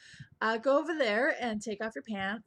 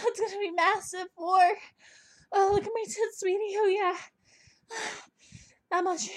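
higher and louder, breaking with excitement; building excitement; 10 semitones higher, 5 dB louder than usual. Acted. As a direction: climb higher and louder to the end. Countdown builds to climax.

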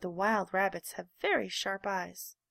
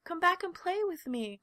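pleading call; 9 semitones higher than usual. A narrator's reading. Little rabbit begs them to come back, high and pleading. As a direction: beg them to return. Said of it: "called the little rabbit".

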